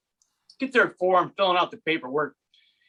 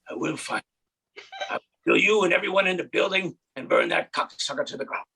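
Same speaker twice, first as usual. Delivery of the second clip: hushed deep vigilante menace; Liam Neeson from Taken; 3 semitones lower than usual. Retold or imitated. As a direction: growl it like a calm killer on the phone. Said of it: "So I went full Liam Neeson from Taken".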